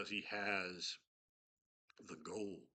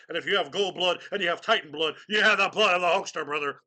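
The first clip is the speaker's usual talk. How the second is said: loud, high wrestler promo voice; Hulk Hogan voice; 9 semitones higher, 15 dB louder than usual. Retold or imitated. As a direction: shout it like a pumped-up wrestler. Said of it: "I can do a very good Hogan".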